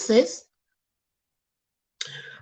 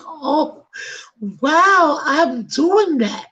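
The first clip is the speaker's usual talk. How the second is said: higher, slowing into a stunned realization; sudden realization; 6 semitones higher, much slower than usual. Retold or imitated. Host recalls realizing her own bias, surprised. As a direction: catch yourself mid-thought, amazed. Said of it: "I was like".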